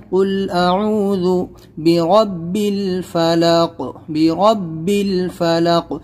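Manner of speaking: slow, with a strong doubled با in برب; tajweed recitation. Shown as a demonstration. Teacher models برب الفلق slowly, doubled ba. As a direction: stress the shadda on برب, ba pressed hard. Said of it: "با میں سختی لانا ہے".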